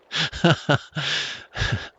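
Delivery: high breathy laughter; helpless giggling laugh; laughing fit. Real, not acted. Laughing at own headshot.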